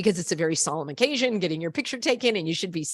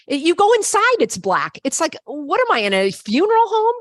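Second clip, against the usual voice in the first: indignant rant; 8 semitones higher, 6 dB louder than usual. Real, not acted.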